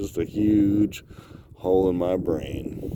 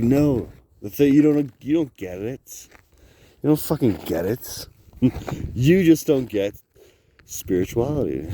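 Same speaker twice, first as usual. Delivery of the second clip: higher, louder frustrated outburst; louder frustrated you don't get it; exasperated rant; 6 semitones higher, 4 dB louder than usual. Real, not acted. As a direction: vent it at them.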